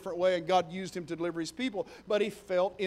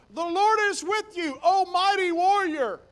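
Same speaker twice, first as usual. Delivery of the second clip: grand proclamation; 10 semitones higher, 7 dB louder than usual. Acted. Angel greets Gideon.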